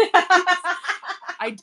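ordinary talk, nothing marked

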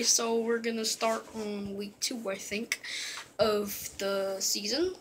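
ordinary talk

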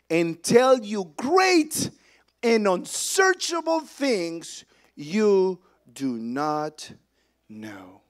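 loud, high scripture proclamation; full-force preaching. Acted. Preacher declaring God's name to the congregation, full-force preaching.

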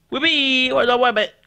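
high squeaky puppet voice, shouting; squeaky toy voice. Pretend. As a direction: play a tiny excited toy friend. Toy character voiced by kid.

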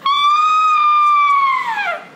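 very high, loud, sustained scream; Tiger's frightened scream. Acted. Tiger reacting to the children's roar, high loud scream. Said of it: "do you know what Tiger said?".

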